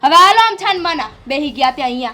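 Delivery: loud, sharp telling-off of her husband; angry scolding. Acted. Wife loudly scolds her husband in front of the mother: angry telling-off.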